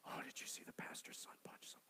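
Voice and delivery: hushed, breathless mock gossip; a staff member whispering gossip about the pastor's son; gossipy whisper. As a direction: whisper it like church gossip behind a hand. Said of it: "whispering or gossiping".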